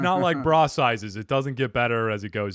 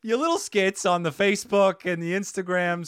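high, mocking voice of well-meaning people; imitating people; 6 semitones higher, 4 dB louder than usual. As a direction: mimic an eager advice-giver, pitched up. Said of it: "A lot of people say that".

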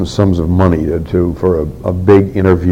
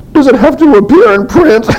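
much higher, lightly put-on mother's voice; imitated mother; 6 dB louder than usual. Mother pleading with her son about the book, voiced much higher. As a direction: pitch it up as his worried little mother.

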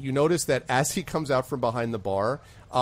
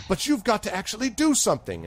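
urging bartender; 11 semitones higher than usual. Acted. Bartender urges Frida.

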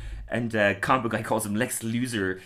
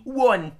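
One pushed higher and louder; stress on One; 13 semitones higher, 5 dB louder than usual. Retold or imitated. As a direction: land hard on One. Regretful emphasis on one.

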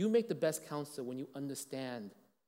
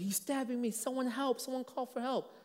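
much higher, frantic cry for help; imitating a desperate cry; 11 semitones higher than usual. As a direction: voice the victim's panic up high. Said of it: "he's like".

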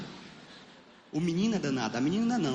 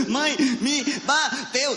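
very slow high sobbing syllables; hammed-up child sobbing; crying in syllables; 9 semitones higher, 3 dB louder, much slower than usual. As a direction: cry it out syllable by syllable. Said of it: "ligando uma moto".

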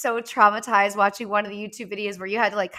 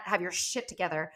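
normal talking voice

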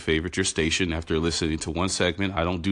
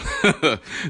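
short laugh bursting out; bright laugh; 8 semitones higher, 3 dB louder than usual. Real, not acted. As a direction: laugh at the thought. Laughing about living amongst family.

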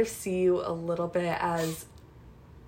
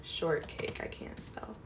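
whisper; 12 dB quieter, slower than usual. Real, not acted. Typing a search, muttering to herself.

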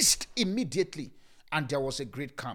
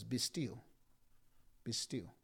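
calm command; 5 semitones lower, 11 dB quieter, slower than usual. Acted. Telling circumstances be still.

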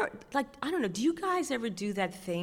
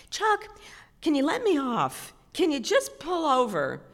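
pleading; 7 semitones higher, 4 dB louder than usual. Retold or imitated. Begging the guide to let her off.

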